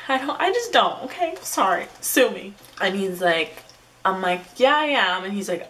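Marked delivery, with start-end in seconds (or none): 0.1-2.5 mock defensive. Real, not acted. Mock-defensive about not rejecting people. 4.6-5.2 high, louder mock-eager yes; agreeable reply. Acts out her agreeable text reply.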